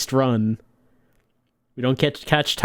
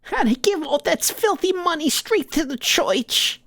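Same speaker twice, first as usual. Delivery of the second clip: put-on much higher old mother voice; old Italian mama; 13 semitones higher than usual. Mama scolding her gangster son, high and tearful. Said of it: "we're talking to Mama".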